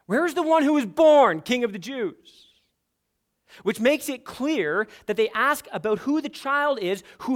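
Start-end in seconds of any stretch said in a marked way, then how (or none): 0.1-2.5 "born" pushed high and loud; preacher hitting "born"; stress on born. Shown as a demonstration. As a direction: land hard on "born" in a higher, louder voice. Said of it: "emphasize the word born".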